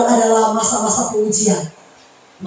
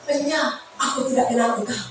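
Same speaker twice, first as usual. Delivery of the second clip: much softer, cold verdict; God's quiet rejection; 7 dB quieter than usual. Pretend. God tells the preacher he never knew him, hushed. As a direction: say it hushed and final. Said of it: "Tuhan bilang".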